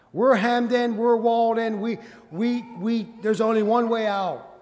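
loud, high-pitched, urgent preaching; full-force preaching. Real, not acted. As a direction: press it on the congregation with rising force.

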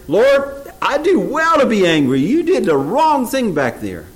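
high, loud mock protest to God; mock angry Jonah. Preacher imagines Jonah still complaining to God in heaven, mock angry. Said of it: "Is Jonah still saying up there".

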